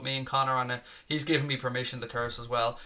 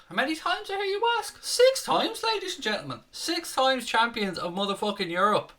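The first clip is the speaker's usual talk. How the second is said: much higher, announcer-style proud cry; triumphant boast; 11 semitones higher than usual. Real, not acted. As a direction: trumpet the six titles like a stadium announcer. Boasting about six European titles.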